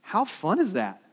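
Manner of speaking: ordinary delivery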